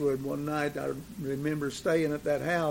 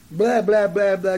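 mimicked rambling talk; 5 semitones higher, 8 dB louder than usual. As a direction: run of louder, higher blahs. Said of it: "just that kind of talk".

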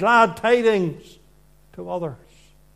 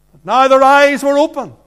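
loud projection; 4 semitones higher, 8 dB louder than usual. Real, not acted. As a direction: proclaim it, loud and full. Preacher proclaiming, raised voice.

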